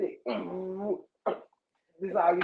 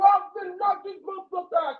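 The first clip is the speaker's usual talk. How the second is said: retold angry shout; 7 semitones higher than usual. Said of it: "'cause I'm mad".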